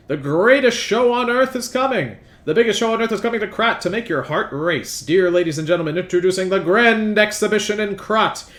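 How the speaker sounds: booming circus barker, building hype; announcer voice. Acted. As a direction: announce it like a grand showman. Reads event flyer aloud.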